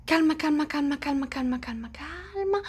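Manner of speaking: self-soothing. Acted. Calming herself, fading down.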